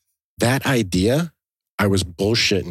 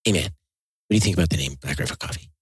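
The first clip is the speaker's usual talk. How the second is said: Evan impression; 4 semitones lower than usual. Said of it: "your Evan".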